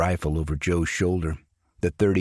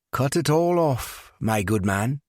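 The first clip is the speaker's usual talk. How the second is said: mock British accent, lightly put on; a young man hamming up a British gent; 7 semitones higher than usual. A narrator's reading. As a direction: barber customer joking in a put-on British accent. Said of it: "he said in a British accent".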